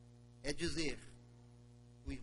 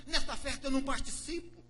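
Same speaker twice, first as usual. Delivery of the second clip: higher and louder, stubborn; angry refusal; 10 semitones higher, 6 dB louder than usual. Acted. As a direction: refuse to join, furious. Boze broer weigert.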